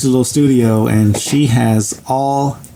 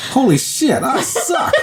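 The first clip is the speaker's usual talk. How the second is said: high-pitched, cracking up by the end; mock horror dissolving into laughter; laughing mock dismay; 12 semitones higher, slower than usual. Student seeing his terrible drawing, retold laughing.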